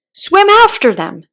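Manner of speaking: high loud slow scream. A narrator's reading. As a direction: scream it at the sea. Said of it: "screamed the Gryphon".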